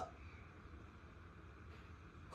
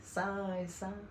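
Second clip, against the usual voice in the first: very soft breathy whoosh; whooshing swing sound; 4 semitones lower, 12 dB quieter, much slower than usual. Acted. Imitating the swing for the sahib, soft whoosh. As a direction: breathe out the swing's rush softly.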